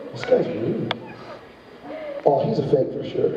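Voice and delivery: low and dismissive, like students sizing someone up; judgy student voice. Retold or imitated.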